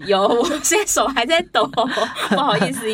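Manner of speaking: laughing through nerves, voice up high; nervous laugh. Real, not acted. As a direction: giggle nervously while apologizing. (紧张地笑着说)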